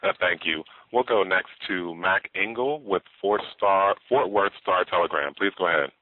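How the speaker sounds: tinny conference-call line; speakerphone. Real, not acted. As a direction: operator heard through a speakerphone. Conference operator over phone line.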